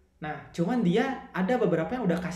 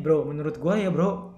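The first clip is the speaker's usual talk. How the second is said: casual bro tone; 4 semitones higher than usual. Shown as a demonstration. Friend giving a buddy advice about posting, in a relaxed bro tone. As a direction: talk to him like an old mate, relaxed. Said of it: "udah ada bronya nih".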